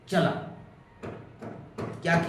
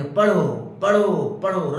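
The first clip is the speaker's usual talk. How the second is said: louder, insistent repeated command; nagging repeated order; 6 dB louder, slower than usual. Retold or imitated. Parent nagging the child to study, loud and insistent. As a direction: hammer the word like a nagging parent. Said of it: "केवल कहेंगे".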